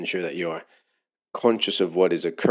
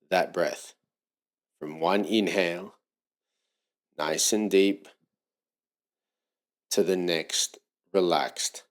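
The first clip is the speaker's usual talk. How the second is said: unhurried yoga teacher settling a class into breathing; guided meditation. Real, not acted. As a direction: ease them into stillness, phrase by phrase. Eases into leading breathing meditation.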